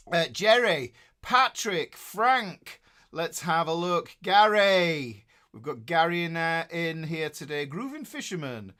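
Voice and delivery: ordinary talk, nothing marked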